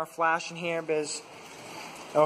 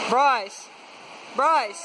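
raised voice calling a name; 6 semitones higher than usual. Real, not acted. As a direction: call his name across the cave. Calling a friend in cave.

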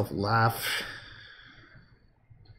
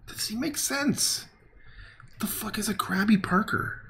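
baffled; 8 semitones higher, 5 dB quieter than usual. Real, not acted. Baffled at strange comment.

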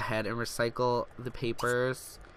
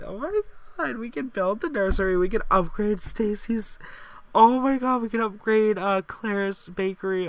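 high, louder gushing joy; excited squeal; 8 semitones higher, 4 dB louder than usual. Real, not acted. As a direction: rattle off the plans in a thrilled squeal. Thrilled planning upgrades.

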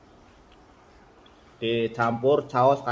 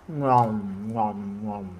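nom nom chomping; slower than usual. Real, not acted. Eating sounds in mukbang.

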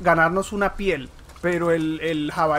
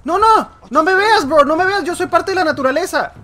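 mock pleading; 7 semitones higher, 8 dB louder than usual. Gamer begging the enemy not to notice him. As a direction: plead with the enemy, pretending to blend in.

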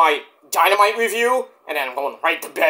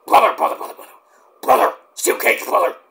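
hammed-up wrestler catchphrase; Hulk Hogan impression; 4 semitones higher than usual. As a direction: bark brother like a wrestling promo. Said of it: "You know, Hulk Hogan".

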